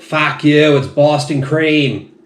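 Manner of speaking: mock-defiant Bostonian, stretching out the words; horrible Bostonian accent. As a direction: belt it out in a hammed-up Boston accent. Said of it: "that was my horrible Bostonian accent".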